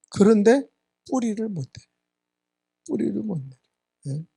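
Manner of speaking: ordinary talk, nothing marked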